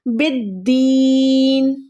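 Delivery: बिद्दीन with a held double d and very long ee; slow chanted बिद्दीन; doubled daal. Shown as a demonstration. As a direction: recite बिद्दीन slowly, leaning on the d, stretching "deeen". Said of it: "दाल यहाँ पर दो बार है".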